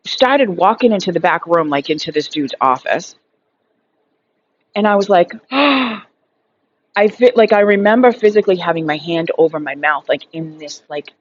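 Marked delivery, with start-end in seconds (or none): 5.5-6.0 shocked gasp. Retold or imitated. Shocked realization in dream.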